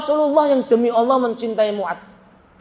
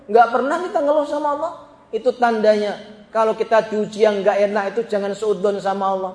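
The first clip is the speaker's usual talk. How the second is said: usual speaking voice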